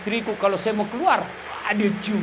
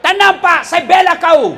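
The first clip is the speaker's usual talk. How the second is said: loud, higher mock promise to the men; hammed-up shouted reassurance; 7 semitones higher, 8 dB louder than usual. Shown as a demonstration. Preacher turns to the husbands, shouting he'll defend them. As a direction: bellow a joking pledge of support. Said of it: "Tenang, Ibu, saya bela kamu".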